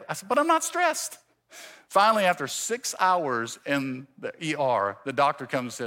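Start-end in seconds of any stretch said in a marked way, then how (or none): 0.3-1.2 high-pitched, squeaky protest; mock defensive denial. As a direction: deny it in a comically high voice. Repeated acted denial of stress.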